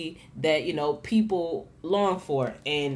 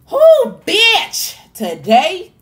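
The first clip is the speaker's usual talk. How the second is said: excited whoop; 14 semitones higher, 14 dB louder, much slower than usual. Real, not acted. Bursting out with sudden excitement.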